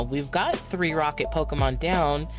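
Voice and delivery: ordinary talk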